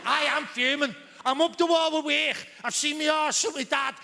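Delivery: high-pitched, ranting Scouse outrage; hammed-up Liverpudlian tirade, stretched out on the furious bits; fuming Scouse. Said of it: "the second state of Scouse being".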